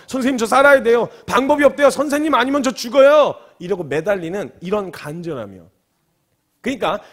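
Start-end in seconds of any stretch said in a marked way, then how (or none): 0.1-3.3 loud, high-pitched acted begging; raised, urgent pleading; desperate clinging plea. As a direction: beg as if clinging to the doctor's trouser leg. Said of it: "물고 늘어져서".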